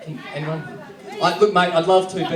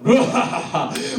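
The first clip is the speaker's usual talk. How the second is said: evil laugh. Acted. Band plotting to take over, evil laugh. Villain laugh after world takeover plan.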